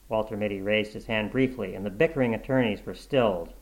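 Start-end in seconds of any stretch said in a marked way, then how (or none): none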